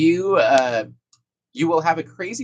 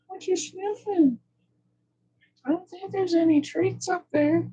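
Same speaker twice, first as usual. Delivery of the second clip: talking to a dog; 4 dB louder, slower than usual. Real, not acted. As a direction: gush over the puppy, sweet and high. Speaker plays with dog.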